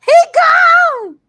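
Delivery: high, loud wailing shout; wailed exclamation; shouted cry. Real, not acted. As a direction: cry it out like breaking bad news. Announcing player left.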